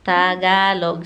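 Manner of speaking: "Tagalog" slowly, stretched into Ta-ga-log; syllable-by-syllable demo. Shown as a demonstration. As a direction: sound out "Tagalog" slowly for a learner.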